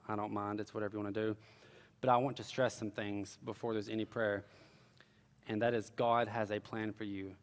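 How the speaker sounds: soft, low, subdued preaching; gentle altar call. Real, not acted. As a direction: bring the sermon down to a quiet, low close. Closes sermon into altar call.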